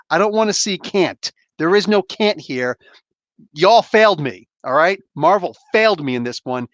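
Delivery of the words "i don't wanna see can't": normal talking voice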